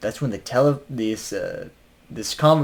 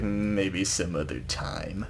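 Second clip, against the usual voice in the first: soft, slow, menacing promise with a rising end; hushed slow taunt; creepy teasing line; 5 semitones lower, 10 dB quieter, slower than usual. Retold or imitated. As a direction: say it low-key like a killer sparing you for now. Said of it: "the way that he says that".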